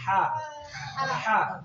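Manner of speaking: "हा" squeezed from mid-throat; throat-made ha. Shown as a demonstration. Teacher shows where the letter comes from, throat-made ha. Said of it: "ये जो गुट्टी है गले की, इससे निकलेगी".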